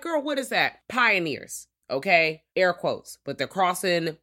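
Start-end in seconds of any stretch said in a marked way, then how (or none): 0.0-0.8 high "Girl, what is that?" in a mock confused voice; puzzled viewer. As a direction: play a baffled listener asking. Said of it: "if you're like".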